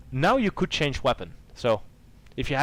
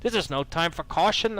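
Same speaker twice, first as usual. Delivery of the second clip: higher, bold warrior voice; eager warrior; 7 semitones higher, 4 dB louder than usual. Acted. Ariadne urging the hero onward, bold higher voice. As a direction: push forward, brave and impatient. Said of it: "mixing up all these accents".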